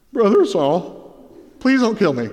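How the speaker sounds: a nervous plea with the voice breaking a little; voice cracking. Retold or imitated. Ananias to his persecutor Saul, voice cracking with fear. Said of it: "I just imagine his voice cracking".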